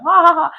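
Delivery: high, loud mock laugh; imitated laughing. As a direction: fake "ha ha ha" at someone's mishap. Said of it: "They would just laugh".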